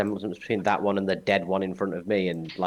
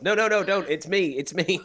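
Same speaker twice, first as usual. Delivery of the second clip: high frantic no no no; panicked pleading; 9 semitones higher, 3 dB louder than usual. Pretend. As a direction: beg them not to shoot. Panicked plea not to shoot.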